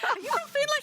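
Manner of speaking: squeaky high-pitched mock question. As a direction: pitch it way up, teasing. Retold quote to interviewee.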